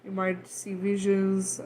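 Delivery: ordinary talk, nothing marked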